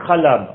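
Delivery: « Chalam » spoken clearly, falling at the end; Hebrew root. Shown as a demonstration. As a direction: say « Chalam » with a guttural ch, stress on -lam. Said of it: "trois lettres". (prononcez « Chalam » avec un ch guttural, en appuyant sur la fin)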